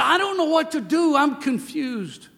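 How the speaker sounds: high, pleading voice, slowing on the end; anguished father quoted. Retold or imitated. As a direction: sound lost and bewildered. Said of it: "he said".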